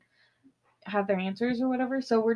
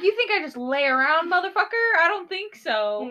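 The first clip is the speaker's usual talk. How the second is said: higher, mock-outraged comeback; indignant retort; 7 semitones higher, 4 dB louder than usual. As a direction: fire back in playful indignation. Retold indignant retort to partner.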